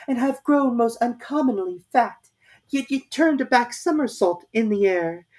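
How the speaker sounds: high, sing-song poem recitation; poem recital. A narrator's reading. Alice reciting Father William to the caterpillar, high and rhythmic. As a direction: recite it like a schoolgirl.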